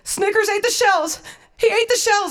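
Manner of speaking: very high, alarmed mimicry; panicked sister. Sister tells Haley the dog ate shells, high acted panic. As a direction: blurt out the emergency in acted panic.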